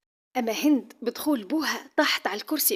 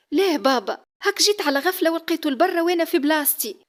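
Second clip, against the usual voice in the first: nervous denial; 6 semitones higher, 5 dB louder than usual. Acted. Daughter nervously denies to father.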